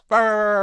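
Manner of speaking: loud, high mock barking; shouted imitation barks of a charging dog. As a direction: yell out the barks like a frenzied dog. Dog charges third time, climax.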